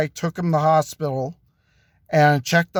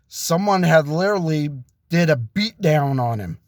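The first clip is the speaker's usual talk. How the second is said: plain reading voice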